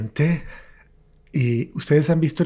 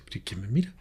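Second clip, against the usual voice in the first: unvoiced, uneasy whisper; whispering nervously in the living room; 3 semitones lower, 7 dB quieter, faster than usual. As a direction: breathe the question as if someone might hear. Senses someone watching him.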